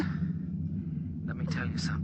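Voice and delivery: hushing her gently; tender whisper over radio. Acted. Commander hushing his love before the signal fades.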